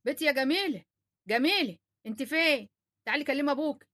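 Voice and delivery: distant call from another room; calling from afar. Acted. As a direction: call her name from far off. Mother calls from inside house.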